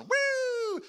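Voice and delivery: high, loud sustained siren whoop; imitated police siren. Storyteller mimicking the police car's lights coming on, loud whoop.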